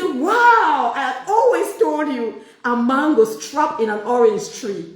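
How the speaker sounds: a mock gasp of self-discovery. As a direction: gush as if proudly revealed. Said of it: "You say".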